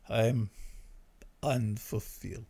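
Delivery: slow, soft, empty-sounding voice; low-energy indecisive guy; unfulfilled guy. Acted. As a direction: sigh it out with no conviction. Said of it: "that is unfulfilled guy".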